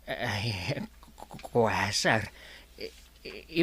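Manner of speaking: low, soft, faltering stammer; cowed underling struggling to get words out; nervous stutter. Acted. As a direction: trip timidly over the first syllables. Nervous underling answers threatening boss.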